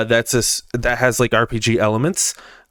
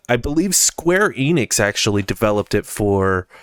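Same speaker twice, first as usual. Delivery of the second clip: normal talking voice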